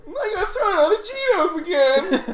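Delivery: very high, laughing whine; John answering his friend; whiny John voice. Acted. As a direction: chirp it like a cheerful loser. GM voicing John to player.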